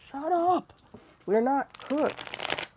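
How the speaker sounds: high, loud and slowed; exasperated retort. Real, not acted. Telling doomers off, slow and loud. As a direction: snap back, drawing out the not.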